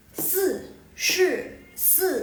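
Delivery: "Si, shi, si" high and falling, with space between; fourth-tone drill. Shown as a demonstration. Teacher drills four-is-four for learners, falling tones. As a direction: punch each of "Si, shi, si" high, then drop. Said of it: "Ini semuanya nada keempat".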